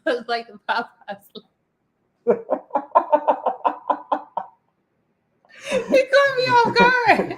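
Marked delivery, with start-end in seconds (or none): 2.2-7.4 laughing. Real, not acted. Host cracking up after flub.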